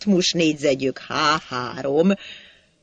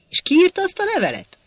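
much higher, fast; eager question; 12 semitones higher, much faster than usual. Acted. Sajó pressing Hacsek fast and high about the letter.

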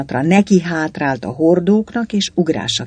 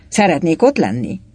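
ordinary delivery

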